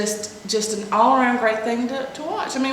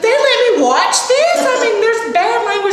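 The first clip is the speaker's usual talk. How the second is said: high, loud outraged shout; mock horror at the movie; shocked disbelief; 12 semitones higher, 7 dB louder than usual. Mom rewatching a childhood movie with her daughter, shocked shout. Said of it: "and I was like".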